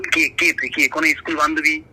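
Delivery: usual speaking voice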